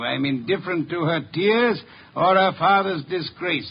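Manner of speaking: ordinary talk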